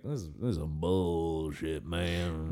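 low, soft, slow grumble; muttered grumble. Retold or imitated. He grumbles to friends leaving, low and soft. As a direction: mutter it under your breath, slowly. Retold grumble walking away.

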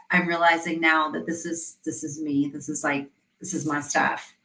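a hushed hint of tears, not real crying; quiet tearful client. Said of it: "she just started crying and she was like".

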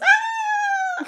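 high shrieking charge yell; battle cry. Pretend. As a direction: shriek like a tiny warrior. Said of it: "short little chibi little hands".

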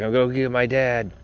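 lightly mimicked friend going for help; retold buddy. Voices buddy leaving for help.

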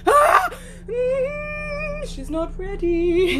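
high startled shriek, then slow; shocked shriek. Retold or imitated. Mum catching grandparents, shrieking.

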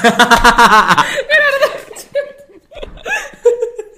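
long high loud cackle; big laughing fit; burst of laughter. Real, not acted.

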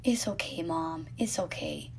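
soft unvoiced comfort, almost no voice; tender whisper. Little son comforting his crying mom, whispered. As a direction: hush it gently like a small child comforting. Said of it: "patted my back and said".

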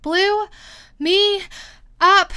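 loud, high, broken word by word; panting. A narrator's reading. Queen blown through the air, panting. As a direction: burst out each word between breaths. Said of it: "panted the queen".

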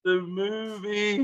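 slow, drawn-out punchline; corny dad laugh. Acted. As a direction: stretch the punchline slowly.

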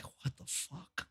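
slow, voiceless whisper of disbelief; acted-out hushed bewilderment; stunned whisper. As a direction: whisper it, drawn out, as a dazed aside. Stunned inner reaction while too high.

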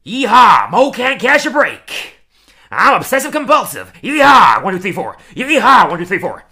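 over-the-top cowboy voice, shouted; Rich Texan impression. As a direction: holler like a rowdy rich Texan tycoon. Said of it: "we'll do my impression".

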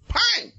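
high, loud "Pang!" like a hard slap; slap sound effect. Retold or imitated. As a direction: shout the slap noise. Preacher enacts slapping the baby.

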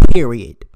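a loud single-word full stop; emphatic period. Real, not acted. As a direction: punch "Period." loud and final. Emphatic end to the rant.

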